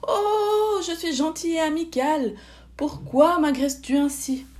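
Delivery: high, loud, wounded protest; wailing fox. A narrator's reading. Startled fox to the attacking owl, wailing protest. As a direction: wail in indignant self-defence. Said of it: "hurla qu'il n'était pas vilain".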